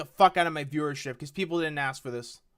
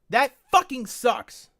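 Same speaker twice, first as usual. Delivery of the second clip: slower, higher, louder; louder angry repeat; 6 semitones higher, 6 dB louder, slower than usual. Real, not acted. Streamer repeating his complaint, louder and fuming. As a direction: lean into it, fuming.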